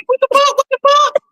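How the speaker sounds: very high-pitched shocked squeal; retold shock. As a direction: re-enact a startled reaction way up high. Retells shocked reaction to the picture.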